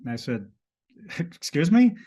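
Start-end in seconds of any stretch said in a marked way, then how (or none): 1.2-2.0 high, loud, incredulous question; a jolt of disbelief, pitched up; startled excuse me. Retold or imitated. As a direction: react like you can't believe what you heard. Stunned reply to a strange claim.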